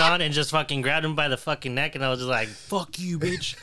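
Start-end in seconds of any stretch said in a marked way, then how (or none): 2.5-3.5 high-pitched heated retort, held back in volume; acted-out fury, pitched up; retold angry outburst. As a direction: replay cussing someone out mid-fight. Retells grabbing the stranger.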